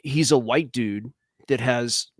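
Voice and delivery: ordinary talk, nothing marked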